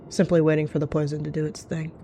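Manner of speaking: ordinary delivery, nothing marked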